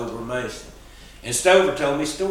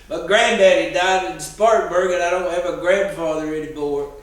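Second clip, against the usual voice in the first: mock sobbing boy; 6 semitones higher, 8 dB louder than usual. Boy blurting his grief.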